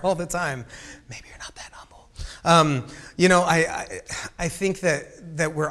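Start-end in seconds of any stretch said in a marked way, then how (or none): 1.1-2.1 unvoiced teasing whisper; whispered aside. Pastor whispers a teasing jab at humble-braggers. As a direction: breathe it like a secret jab.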